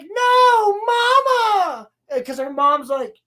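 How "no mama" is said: very high, loud cry; mock anguished wail. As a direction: wail for mama, hammed-up. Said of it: "and she's like".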